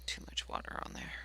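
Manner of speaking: quick whispered note to self; whispering. Real, not acted. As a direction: whisper it under your breath. Painting, muttering to herself.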